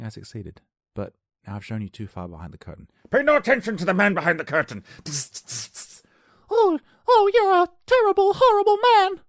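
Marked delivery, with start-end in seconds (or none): 3.1-4.8 loud, high mock proclamation; mock bellowing wizard. Comic quoting the man behind the curtain, mock bellow. 6.5-9.2 piercingly high, loud mock girl voice; Dorothy impression. Dorothy scolding the wizard, loud falsetto impression. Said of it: "That's exactly how she sounds".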